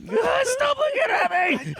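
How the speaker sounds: high-pitched mock tearful scream; panicked shriek. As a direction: shriek it like a humiliated wailing baby. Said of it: "and sweat and the panic".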